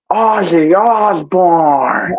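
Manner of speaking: slow, drawn-out movie-character impression; Little Nicky. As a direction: stretch the name out in a put-on voice. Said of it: "my best Little Nicky impression".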